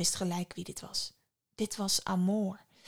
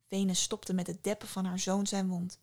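usual speaking voice

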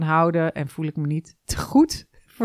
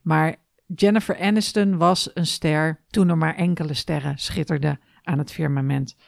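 usual speaking voice

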